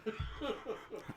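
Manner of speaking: high, breath-only chuckling; laugh. Real, not acted. Speaker amused after saying no thanks, chuckling. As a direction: chuckle without voicing it.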